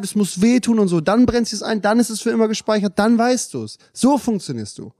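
retold heated lecture, high-pitched and urgent; impassioned insistence. Retelling friend's impassioned lecture on learning.

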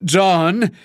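high-pitched call, rising; calling loudly. A narrator's reading. Suko calls his partner over the din, loud rising call. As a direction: raise pitch and call to be heard. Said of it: "rief Suko laut genug".

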